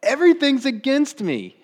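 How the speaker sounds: high, loud melodramatic wail; hammed-up despair; despairing Jacob. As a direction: wail it like all is lost. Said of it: "finishes it off".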